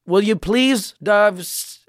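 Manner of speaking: put-on high, scolding Filipino parent accent. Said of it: "this Filipino parent accent".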